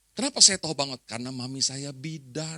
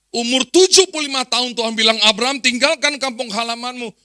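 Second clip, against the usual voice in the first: loud, raised-pitch pulpit proclamation; full-force preaching; 8 semitones higher, 12 dB louder than usual. Real, not acted. Preacher extols Abram's trust in God, full-force preaching. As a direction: drive the point home to the congregation.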